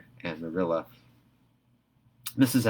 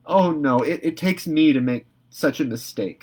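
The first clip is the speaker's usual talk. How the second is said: forlorn; 6 semitones higher, 5 dB louder than usual. A narrator's reading. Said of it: "said Anne forlornly".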